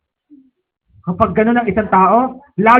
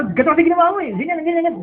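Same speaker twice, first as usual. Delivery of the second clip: mock scolding; 7 semitones higher, faster than usual. Shown as a demonstration. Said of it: "Huwag mo lang didemo".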